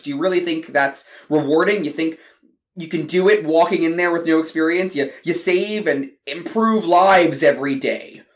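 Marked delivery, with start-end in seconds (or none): none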